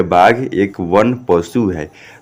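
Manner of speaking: slow, separated Hindi words; model reading. Shown as a demonstration. Teacher reading out the Hindi translation for students, slow word-by-word. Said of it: "क्या पढ़िएगा".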